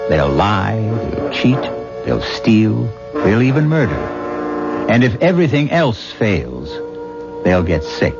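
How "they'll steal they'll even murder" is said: ordinary delivery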